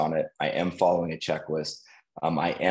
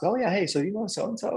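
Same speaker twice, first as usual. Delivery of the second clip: much higher, offhand voice; mock casual question; 14 semitones higher, faster than usual. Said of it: "and he'll ask".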